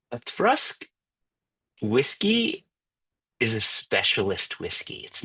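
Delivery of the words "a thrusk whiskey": lightly imitating Jason's Scottish accent, much higher; a playful nod to Jason's Scottish lilt. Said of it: "So when he talks about a Thrusk".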